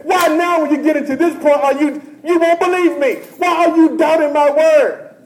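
loud, high, building to a shout; rising shouted rebuke; voicing God. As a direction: preach God's anger at full force. Preacher voicing an angry God.

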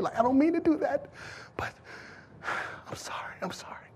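soft, tearful begging, fading to a whisper; broken whispered plea. Acted. Weeping woman apologizing to Jesus, hushed tearful plea. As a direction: plead softly, falling apart at the end. It's like she's crying.

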